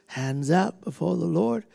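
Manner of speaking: usual speaking voice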